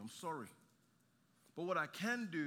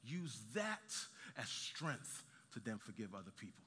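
normal talking voice

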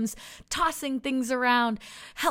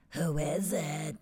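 low alien voice; 9 semitones lower, 6 dB quieter, slower than usual. A narrator's reading. Brad the alien answers the door.

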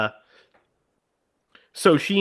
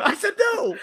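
very high-pitched mock outburst; freaking out. Echoing the driver's outburst, mock freak-out. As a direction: squeal it as a hammed-up refusal. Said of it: "Freaks out".

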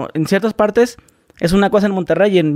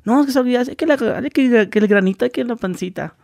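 higher, whiny complaining about looks; mock insecure complaint; 6 semitones higher than usual. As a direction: imitate someone fretting over their looks. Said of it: "Como otras personas de que". (queja insegura, aguda)

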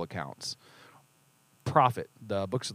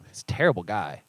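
usual speaking voice

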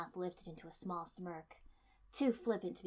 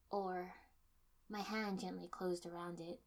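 ordinary delivery, nothing marked

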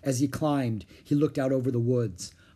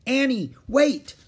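high, loud, drawn-out call; urgent storybook shout to wait; 12 semitones higher, 7 dB louder, slower than usual. A narrator's reading. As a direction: call after a friend running off. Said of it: "Jack called".

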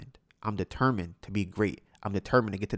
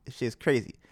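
ordinary talk, nothing marked

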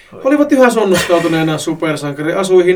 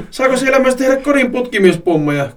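higher-pitched, tickled curiosity; gleeful chuckling question; 7 semitones higher than usual. A narrator's reading. Joni asks Late about the seller, gleeful chuckling question. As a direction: ask it with giddy glee. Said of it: "kysyi Joni hykerrellen".

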